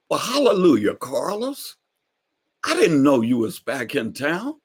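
beam out hallelujah at him; old preacher neighbor. Retold or imitated. Neighbor greeting Carlos on his porch, high praising old man.

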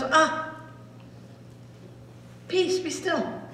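Jesus calmly stilling the storm. Acted. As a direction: settle the storm gently.

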